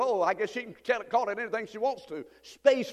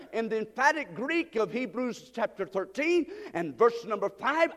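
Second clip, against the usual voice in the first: ordinary talk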